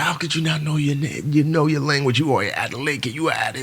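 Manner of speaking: incredulous scolding. Retold or imitated. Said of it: "He's like".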